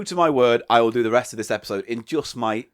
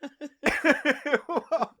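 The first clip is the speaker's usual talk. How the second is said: high-pitched giggling speech; laughing; 12 semitones higher than usual. Real, not acted. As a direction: talk through a giggle. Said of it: "why are you laughing".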